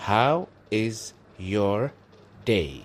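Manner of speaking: How is your day spoken very slowly, each word drawn out; very slow demo. Shown as a demonstration. Teacher modelling the phrase for students, very slow. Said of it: "Waqtigaada qaado".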